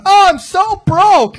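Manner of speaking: high, loud, drawn-out mock lament; whining rich comic. Comic mocking rich comics who plead poverty, high exaggerated whine. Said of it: "like, a rich comic going".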